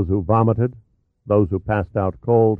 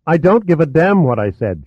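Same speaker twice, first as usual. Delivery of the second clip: higher and louder, a furious outburst; furious cry; 7 semitones higher, 3 dB louder than usual. A narrator's reading. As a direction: lash out at him in fury. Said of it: "she cried".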